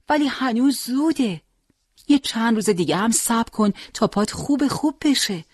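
high, lightly voiced young nurse protesting; caring nurse. A narrator's reading. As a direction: plead gently as the nurse.

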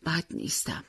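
tired, soft-spoken reply after a sigh; a hint of a sick mother's sigh; weary sigh. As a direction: sigh it out, quietly worn down. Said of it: "مادر آهی کشید و گفت".

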